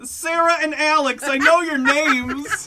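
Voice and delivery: laughing. Real, not acted. Defensive laughing insistence.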